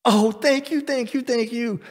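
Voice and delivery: high squealing little princess; overjoyed girl, acted big and high; gushing thanks. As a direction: gush gratefully in a very high voice. Princess thanks frog for helping.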